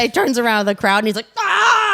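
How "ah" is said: high imitated scream; short hammed-up shout of fury; mad yell. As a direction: yell out in mock rage. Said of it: "And then he gets mad".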